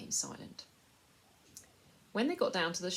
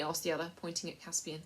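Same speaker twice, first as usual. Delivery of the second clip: plain reading voice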